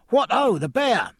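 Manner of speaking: high, loud happy sailor's shout; a merry shout across the quay; cheerful cry. Acted. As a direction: call out gleefully, well fed and tipsy. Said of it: "cried a sailor, quite happy now".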